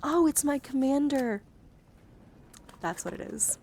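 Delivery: ordinary talk, nothing marked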